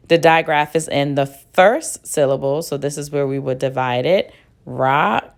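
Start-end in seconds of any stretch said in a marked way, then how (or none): none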